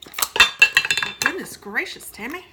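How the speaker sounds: a sudden loud startled outburst; startled exclamation. Real, not acted. Home baker startled by the spray can, loud surprise. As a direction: caught off guard, blurting it out loud.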